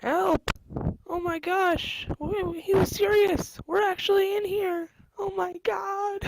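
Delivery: high, frantic calls for help; panicked cries. Acted. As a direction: cry out like a trapped prisoner. Prisoners trapped, calling for help.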